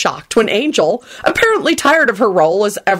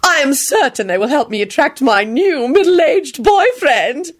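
high, slow, lilting grande dame; pleased, fluting lady; happy trill; 7 semitones higher than usual. A narrator's reading. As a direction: trill it with smug delight. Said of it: "she trilled happily".

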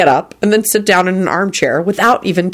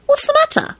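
very high worried question; anxious. A narrator's reading. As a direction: ask your lover anxiously, pitched way up. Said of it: "she said anxiously".

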